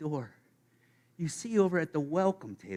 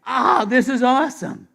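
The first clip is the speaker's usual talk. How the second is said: much higher, louder, slow thrilled cry; 9 semitones higher, 11 dB louder, slower than usual. Pretend. Believer spots unexpected friends in heaven, thrilled. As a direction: whoop with delight. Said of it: "I'd be like".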